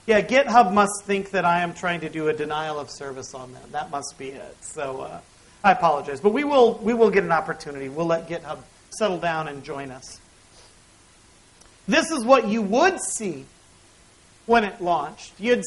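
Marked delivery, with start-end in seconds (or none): none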